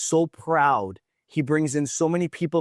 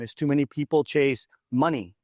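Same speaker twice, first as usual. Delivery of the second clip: normal talking voice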